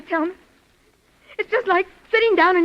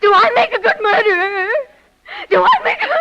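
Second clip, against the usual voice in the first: much higher and louder, sobbing into laughter; hysterical crying laugh; 11 semitones higher, 7 dB louder than usual. Acted. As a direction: lose control, cry and laugh at once. Hysterical breakdown after confession.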